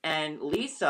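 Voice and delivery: ordinary delivery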